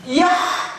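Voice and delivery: loud, high yell of pent-up anger; explosive outburst. Retold or imitated. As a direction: let the bottled-up rage burst out in a yell. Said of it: "터져나오기 시작했어요".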